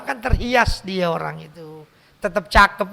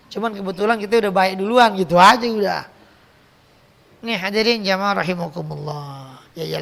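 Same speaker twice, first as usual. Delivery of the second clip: normal talking voice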